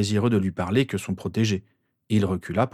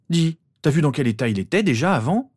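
higher, defensive pitch; bad-faith protest; 6 semitones higher than usual. A narrator's reading. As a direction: protest as if the blame were unfair. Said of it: "protesta Harry avec mauvaise foi".

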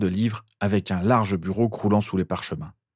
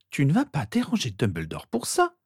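put-on high, scandalised girl's voice; shocked; 6 semitones higher than usual. A narrator's reading. Sister hearing he would disturb Dumbledore, shocked. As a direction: object in high-pitched shock. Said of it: "s'écria Rose, manifestement choquée".

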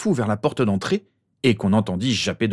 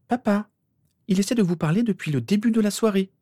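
put-on much higher girl's voice; pleading daughter; 13 semitones higher than usual. A narrator's reading. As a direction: appeal to your father in a high voice.

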